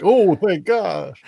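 relieved ex quote. Retold or imitated. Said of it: "they're the ones who are like".

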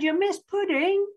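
high-pitched call to a friend; high, carrying child's call. A narrator's reading. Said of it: "called her friend".

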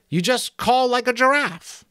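very high, loud thin voice; soothing sentry. Acted. Sentry revealing the new password, very high piping voice. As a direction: offer it helpfully, pitched right up high. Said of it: "the sentry answered soothingly".

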